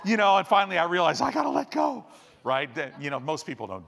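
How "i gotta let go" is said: raised pitch, loud and hurried; mock struggling cry. Preacher reliving being dragged underwater, mock struggling cry. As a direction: call it out as if being dragged along.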